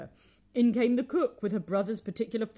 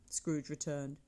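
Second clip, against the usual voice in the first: ordinary delivery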